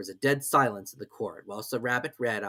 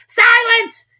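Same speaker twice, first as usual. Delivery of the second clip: very high, very loud king's shout; king silencing the court; called out; 15 dB louder than usual. A narrator's reading. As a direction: bellow for silence. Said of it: "called out".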